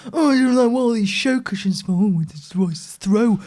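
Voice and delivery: mock typical bloke; lightly put-on contented man voice. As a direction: imitate a man gushing over comfy bedding. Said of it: "like a typical man and went".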